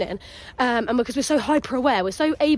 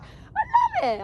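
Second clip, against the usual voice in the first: very high, drawn-out acted delight; delighted squeal; much slower than usual. Swimmer in her element loving it, delighted squeal.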